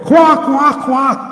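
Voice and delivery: high mocking quacks like a duck. Mocking followers as ducks.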